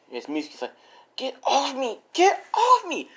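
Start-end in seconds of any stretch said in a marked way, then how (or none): 1.2-3.1 very high-pitched mock scream; shrill-pitched retold protest; acted panicked cry. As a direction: voice a struggling woman in high falsetto. Acted wife fighting off her attacker.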